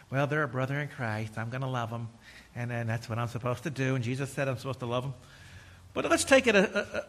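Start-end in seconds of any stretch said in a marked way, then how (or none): 0.1-5.1 low, self-satisfied mumble; smug churchgoer, lower and softer. Retold or imitated. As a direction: sound pleased with yourself, pitched down.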